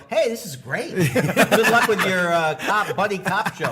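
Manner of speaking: high, slow, laughing fan voice; mock fan. A hint of a fan cheering the comic on. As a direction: gush like a clueless fan. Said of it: "Dane's fans will go".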